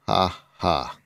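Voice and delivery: dry, unamused ha ha; deliberately hollow, spaced-out laugh; fake laugh. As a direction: fake a laugh at a bad joke. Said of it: "Tracy made a fake laugh".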